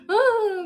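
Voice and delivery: high, loud mock wail; imitated wail. Imitating friend on hearing miss you, wailing. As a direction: copy her tearful cry. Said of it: "ganon siya".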